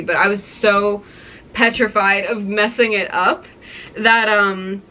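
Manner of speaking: ordinary talk, nothing marked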